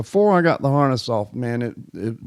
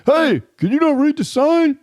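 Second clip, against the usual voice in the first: high, mocking scold; imagined scold; 13 semitones higher, 4 dB louder than usual. Imagined stranger mocking the blind man, high scold. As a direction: sneer it like a busybody. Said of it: "somebody was gonna say".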